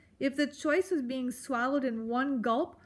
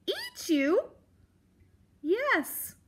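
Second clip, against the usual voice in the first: character voices; 12 semitones higher, 3 dB louder, much slower than usual. A narrator's reading. Porcupine asks, Poppy answers.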